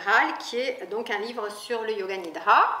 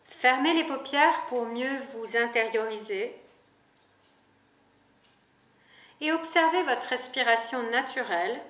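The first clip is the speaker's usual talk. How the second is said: guided meditation. Acted. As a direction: lead the listener gently into rest, unhurried. Guided Yoga Nidra reading.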